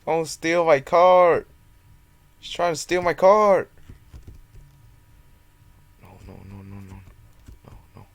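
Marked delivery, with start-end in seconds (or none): 0.1-3.7 shout. Real, not acted. Someone stealing his car.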